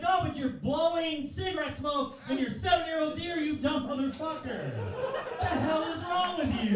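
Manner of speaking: high-pitched, outraged scolding; exasperated shouting. Acted. Comic to smoke-blowing relatives, exasperated shouting. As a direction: yell it in disbelief, stretching the last question. Acted argument back at relatives.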